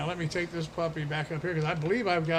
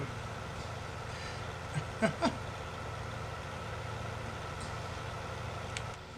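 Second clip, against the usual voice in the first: long wheezing laugh; 5 semitones higher, 8 dB quieter than usual. Real, not acted. Laughing at the joke.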